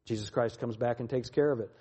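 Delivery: normal talking voice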